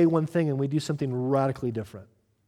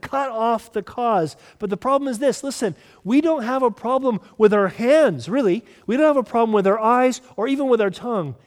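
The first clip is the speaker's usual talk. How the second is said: loud, rising preacher's call, hitting each phrase; preaching at full force; 5 semitones higher, 5 dB louder than usual. Acted. Pastor rousing his church, preaching at full force. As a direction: drive it home to the congregation.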